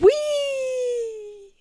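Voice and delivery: very high squealing whee; flying whee. Acted. Troll hurled into the clouds, squealing with glee. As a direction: let it fly off into the sky.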